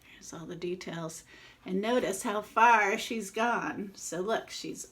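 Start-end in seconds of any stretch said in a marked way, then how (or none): 0.0-1.2 hushed whisper. Real, not acted. Aside to kids showing picture.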